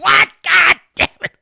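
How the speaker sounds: high, loud yell of frustration; retold yell. Driver yelling alone at the radio, a loud frustrated shout. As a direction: shout it like you just caught the mistake. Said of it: "I yelled as I was driving".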